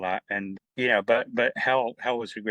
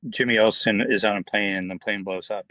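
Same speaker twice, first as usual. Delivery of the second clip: ordinary talk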